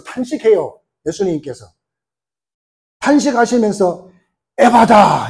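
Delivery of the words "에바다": acted command. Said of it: "이렇게 하는 것입니다".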